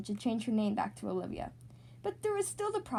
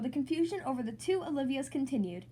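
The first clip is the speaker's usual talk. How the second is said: ordinary delivery, nothing marked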